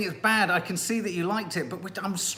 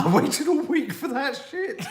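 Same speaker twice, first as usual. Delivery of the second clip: higher, slow, laughing shout; laughing outrage; 8 semitones higher than usual. Real, not acted. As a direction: rant through laughter.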